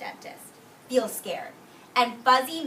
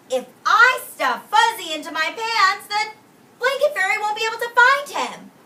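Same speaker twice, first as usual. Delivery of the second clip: put-on high, loud, excited child voice; a clever kid sure of his plan; Owen's thought; 11 semitones higher, 10 dB louder than usual. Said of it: "I bet Owen is thinking".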